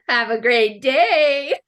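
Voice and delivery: slow, laughing toy doll voice; a talking doll's canned line, giggling through it; mock doll message. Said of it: "you press the ball and it's like".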